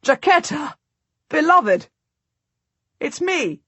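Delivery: high, calling out her name in delight; joyful yelling. A narrator's reading. Husband calling his wife by name from his horse, joyful yell. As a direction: shout to her across the road. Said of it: "my husband yells".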